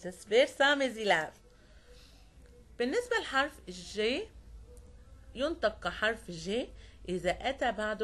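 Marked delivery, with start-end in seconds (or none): none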